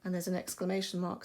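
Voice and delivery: ordinary delivery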